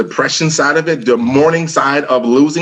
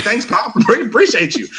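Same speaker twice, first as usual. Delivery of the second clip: higher, mock-grateful thanks with a laugh; sarcastic thanks; 9 semitones higher than usual. Son sarcastically thanking his dad as he leaves, wry.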